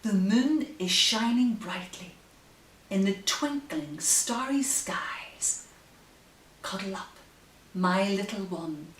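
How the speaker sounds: gentle sing-song rhyme reading; reading to children. Acted. Bedtime reading the rhyme aloud to her baby. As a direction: read the rhyme like a lullaby.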